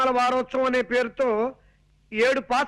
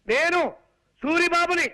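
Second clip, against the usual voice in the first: higher, raised-voice announcement of who it is; shouting to be heard; 5 semitones higher, slower than usual. Acted. Son-in-law announcing himself to his deaf aunt, shouting. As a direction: call it out for someone hard of hearing. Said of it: "అంత గట్టిగా అరవకు నాయనా".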